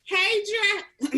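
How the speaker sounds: bright, loud, high hello; excited greeting. Real, not acted. Greeting fan Jeff with excitement. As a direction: burst out with a greeting. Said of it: "Let me do that again. I'm getting so excited".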